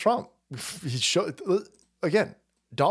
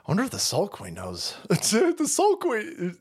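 usual speaking voice

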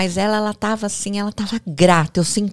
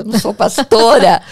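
loud, raised-pitch retort; exclaimed protest; 4 semitones higher, 12 dB louder than usual. Retold or imitated. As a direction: protest it loudly, half laughing at the idea. Protests to women mistaking her for pastor.

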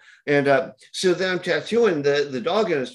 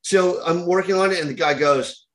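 ordinary talk, nothing marked

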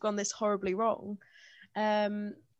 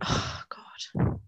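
retold weary complaint; 4 dB quieter, much slower than usual. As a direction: sigh out the "Oh, God" as if imitating him. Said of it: "he sort of sat there and went".

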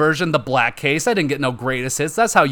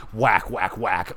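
mock hit sounds; 4 semitones lower, 4 dB quieter, much slower than usual. Imitating dildo hits.

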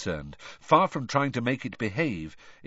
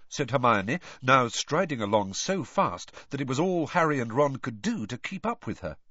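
ordinary delivery, nothing marked

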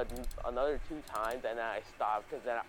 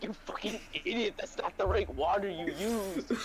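mock angry mom; 6 semitones higher than usual. Mom scolds kid, laughing.

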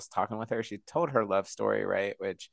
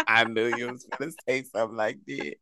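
laughing; faster than usual. Real, not acted. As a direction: laugh through the words. Laughing at daughter's answer.

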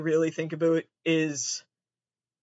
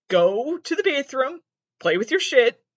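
exasperated telling-off; 7 semitones higher, 6 dB louder than usual. Real, not acted. Telling off Maria.

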